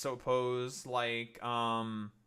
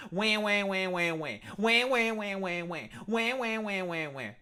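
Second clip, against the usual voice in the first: high, loud mock baby crying; hammed-up wah wah crying taunt; 6 semitones higher, 3 dB louder than usual. Mocking critics as crybabies.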